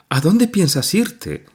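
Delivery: disbelieving mother asking where; surprised. A narrator's reading. Mother astonished that her daughter wants to leave. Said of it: "extrañada".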